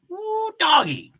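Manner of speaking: high, squealing delight; a sudden high excited cry. Real, not acted. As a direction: yelp it out like a big surprise. Excited at a race position jump.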